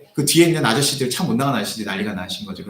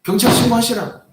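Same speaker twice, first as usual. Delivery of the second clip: much higher, louder retold yell; shouting; 10 semitones higher, 6 dB louder than usual. Pastor daring the old man to call the police, shouting. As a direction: throw the dare at him. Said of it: "싸움이 나요".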